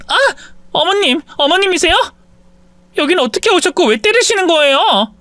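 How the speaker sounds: very high panicked shriek; daughter-in-law shrieking as her mother-in-law beats her; shrieking in pain. Acted. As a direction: scream as the blows land. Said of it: "며느리가 소리쳤습니다".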